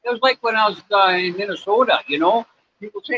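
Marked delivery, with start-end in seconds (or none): none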